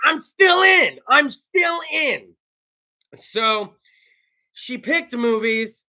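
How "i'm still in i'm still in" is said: eager shout. Retold or imitated. Host retelling his answer to his girlfriend, eager shout. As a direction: insist you're in anyway. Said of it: "So I was like".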